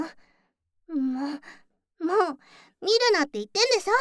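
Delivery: pouting, half-laughing tsundere outburst; flustered scolding. Real, not acted. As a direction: scold him to hide her blushing. Flustered scolding after compliment.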